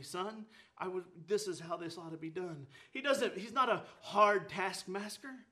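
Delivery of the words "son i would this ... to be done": low, soft fatherly correction; gentle. Acted. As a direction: say it gently, lower and softer, guiding a son. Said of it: "ever so gently".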